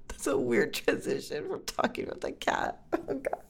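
cracking up, words squeezed out softly; laughing. Real, not acted. Laughing at own weird transition from the cat, helpless giggly voice.

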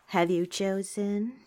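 ordinary delivery

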